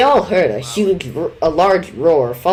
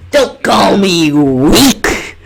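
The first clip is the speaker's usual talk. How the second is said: slow, loud, tearful snarl; angry; 3 semitones higher, 9 dB louder, much slower than usual. Acted. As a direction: spit it back, wounded and furious. Villain retorts angrily.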